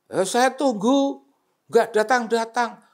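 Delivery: high, loud peeved complaint; complaining caller. Retold or imitated. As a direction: grumble about being kept waiting.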